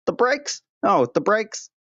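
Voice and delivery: mock hurt surprise. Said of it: "You'd be like".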